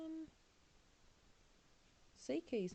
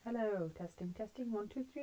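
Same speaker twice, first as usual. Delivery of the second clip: underwater; 3 semitones higher than usual. Real, not acted. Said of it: "sounds a bit like you're underwater".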